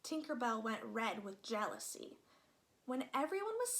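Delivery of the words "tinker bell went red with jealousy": plain reading voice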